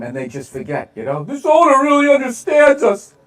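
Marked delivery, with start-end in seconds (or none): 1.2-3.1 high, gushing fan impression; dopey fan voice. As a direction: coo like a starstruck fan. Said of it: "He rubs their balls every game".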